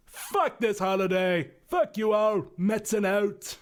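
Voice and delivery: foul-mouthed Scottish ranting reindeer; Billy Connolly voice. Retold or imitated. Showing how the reindeer Metzen sounded, Scottish accent. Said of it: "Billy Connolly voice, so he literally sounded like this".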